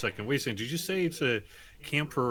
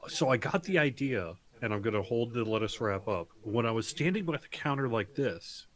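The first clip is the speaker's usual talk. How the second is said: normal talking voice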